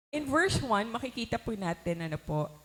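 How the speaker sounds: normal talking voice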